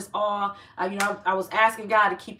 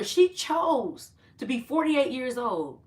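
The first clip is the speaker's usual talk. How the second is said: normal talking voice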